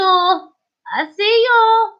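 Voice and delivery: slow, high teasing singsong; teasing sing-song. Acted. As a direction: stretch it out like a playful spook. Teasing viewer under spotlight.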